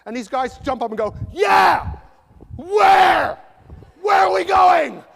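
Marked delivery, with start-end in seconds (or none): 1.3-5.0 shouted, high-pitched hyped American voice; loud, hammed-up American enthusiasm; American accent. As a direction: holler it like an over-eager American audience. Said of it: "In an American accent".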